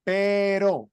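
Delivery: "Pero" with a single tapped r, like the tt in matter; Spanish pero. Shown as a demonstration. Teacher models "Pero" for the student to repeat. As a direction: tap the r in "Pero" once, lightly. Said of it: "use to pronounce this word".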